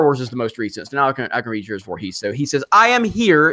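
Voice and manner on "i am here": reading the caps as a raised shout; quoting a chat message in all caps, raised voice; mock all caps shout. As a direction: belt it like an all caps tweet. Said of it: "in all caps".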